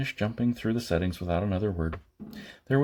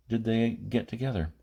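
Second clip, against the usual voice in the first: ordinary delivery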